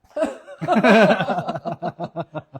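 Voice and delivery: loud burst of laughter. Real, not acted. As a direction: crack up loudly. Caught eating, laughs loudly.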